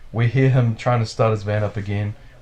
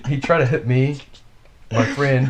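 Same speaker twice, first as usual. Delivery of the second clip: higher, louder mock of the driver's complaint; 3 semitones higher, 3 dB louder than usual. Teller parodying the driver's accusation, mock whine. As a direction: parody his whining accusation.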